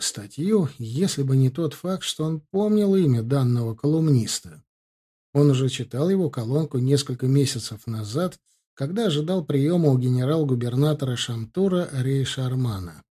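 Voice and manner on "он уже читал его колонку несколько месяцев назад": ordinary delivery, nothing marked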